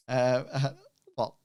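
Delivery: usual speaking voice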